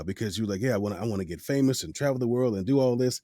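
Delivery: usual speaking voice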